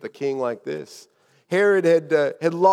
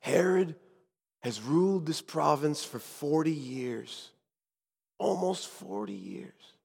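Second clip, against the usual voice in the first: soft, hushed account with pauses; whispered tones; 6 dB quieter than usual. Acted. Guide telling Azariah what Herod has done, hushed. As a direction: confide the grim history under your breath. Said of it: "And in whispered tones, I said".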